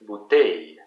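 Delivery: "bouteille" with emphasis on "teille"; last-syllable stress. Shown as a demonstration. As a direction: demonstrate French "bouteille", ending stressed. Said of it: "the last one that is the most emphasized".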